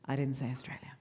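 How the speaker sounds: conspiratorial, playful whisper. Real, not acted. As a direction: keep the secret in a teasing whisper. Playfully denies moving home.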